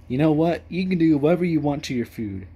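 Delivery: ordinary talk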